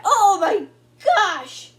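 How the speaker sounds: high, loud, drawn-out amazement; amazed outburst. Real, not acted. Collector stunned by the card, amazed shout. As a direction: shout it slowly, stretched out in shock.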